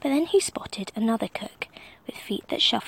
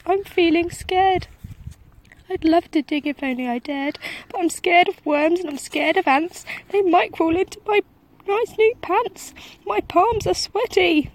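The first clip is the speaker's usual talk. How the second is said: high, loud trembling cook voice; scared cook; 8 semitones higher, 7 dB louder than usual. A narrator's reading. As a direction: babble your fears like a terrified wimp. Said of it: "the cook declared".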